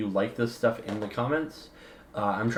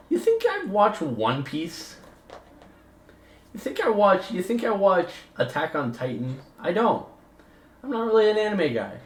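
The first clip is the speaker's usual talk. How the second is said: mock incredulity; 10 semitones higher than usual. Real, not acted. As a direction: pitch it way up, as if the idea is absurd. Mock-incredulous rhetorical questions about anime.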